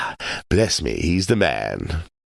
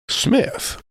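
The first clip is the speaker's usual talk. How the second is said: drawled. A narrator's reading. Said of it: "drawing out his syllables".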